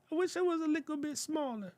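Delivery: high, soft, fast squeak; whiny little falsetto wish; silly high voice. Pretend. Playful aside resizing window.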